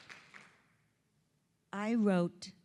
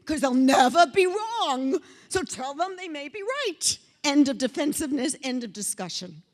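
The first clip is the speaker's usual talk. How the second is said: loud, higher-pitched insistence; emphatic outburst; 5 semitones higher, 8 dB louder than usual. Real, not acted. Speaker telling audience how to handle arguers, loud and emphatic. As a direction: belt it out in comic exasperation. Emphatic aside on never being wrong.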